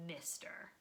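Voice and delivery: low, whispered stern "mister"; soft whispered threat to a child; hushed warning. Acted. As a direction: drop it to a firm whisper. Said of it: "His parents were fed up".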